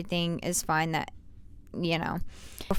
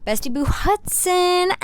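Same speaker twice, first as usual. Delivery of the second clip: high, loud, delighted reveal; gleeful announcement; 11 semitones higher, 6 dB louder than usual. Real, not acted. Telling viewers who Addie calls, gleeful high announcement. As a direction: announce him like your favourite person. Excitedly introduces a favourite character.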